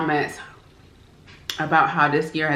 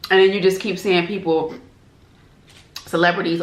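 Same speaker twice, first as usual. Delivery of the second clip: usual speaking voice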